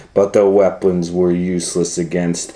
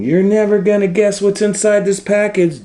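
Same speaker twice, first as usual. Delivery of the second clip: teasing shout; 10 semitones higher than usual. Acted.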